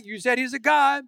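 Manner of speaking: ordinary talk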